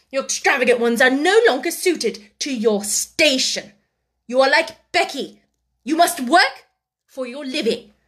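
high, loud, emphatic decree; severe scolding. A narrator's reading. Headmistress strips the pupil of privileges, severely. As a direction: hammer out each new rule. Headmistress lays down new rules.

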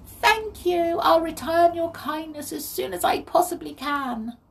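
high, loud, grateful little voice; pixie calling out. A narrator's reading. Freed pixie calls to Molly, grateful. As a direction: call thanks in a tiny high voice. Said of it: "she called to Molly".

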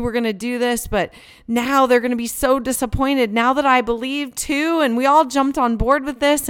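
frustrated, worried bargaining; pleading with God. Retold or imitated. Mom talking to God inside, a worried plea. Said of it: "I'm talking to God, and I'm saying things like".

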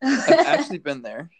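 bursting into a laugh as you say it. Real, not acted. Telling the host about living in Singapore, laughing.

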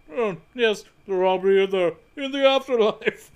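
Robin Williams impression. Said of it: "That's more like Robin Williams".

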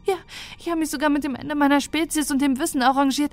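tearful despair. Acted. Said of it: "Tränen in ihren Augen".

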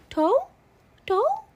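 imitating toddler. Mom imitating her toddler handing her a dinosaur, high toddler voice. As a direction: chirp it up high like a toddler handing something over. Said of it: "He goes".

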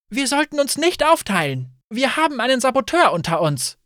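high, pressing plea; alarmed objection; urgent warning. A narrator's reading. Urgent warning to the splitting group.

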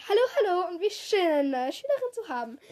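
höher und lauter, überschwänglich; schwärmen wie eine überdrehte Theaterlehrerin; schwärmend. A narrator's reading. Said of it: "schwärmte sie". (Misses P schwärmt von der neuen Schülerin)

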